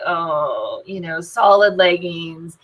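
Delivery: underwhelmed, slow and deflated; mock disappointment. Seller opening a box of dull leggings, mock let-down. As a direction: let 'oh' sink low, then drag it out slowly.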